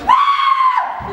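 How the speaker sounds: long, piercing high scream; mimicked wife's shriek. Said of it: "Myra screams".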